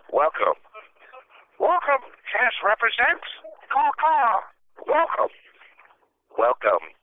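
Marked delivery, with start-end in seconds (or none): 1.6-5.3 very high screechy bird voice; shrill screeching bird woman. Acted. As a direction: squawk the greeting like a parrot.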